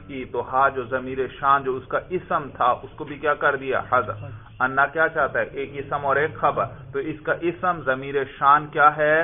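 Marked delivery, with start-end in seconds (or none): none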